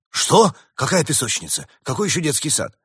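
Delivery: high startled questions. A narrator's reading. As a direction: jump at the words, alarmed. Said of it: "Доктор вздрогнул".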